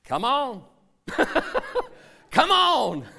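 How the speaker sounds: amused call. Acted. Jesus inviting Peter, laughing.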